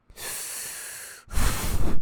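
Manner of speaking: loud winded exhale; heavy panting sigh. Shown as a demonstration. Out of breath from going down the stairs. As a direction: blow out like you just climbed stairs. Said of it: "that's how I would breathe normally".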